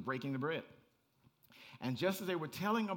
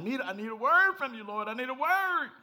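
higher, louder mock plea to the Lord; pleading churchgoer; 9 semitones higher, 10 dB louder than usual. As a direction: beg for a word like a needy churchgoer. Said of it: "you're like".

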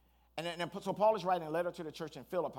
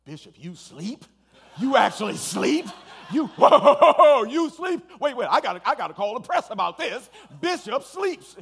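high, loud laughing teasing voice, much louder; laughing disbelief; 9 semitones higher, 20 dB louder, slower than usual. Retold or imitated. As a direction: cackle in amazed disbelief. Friend teases him for sleeping.

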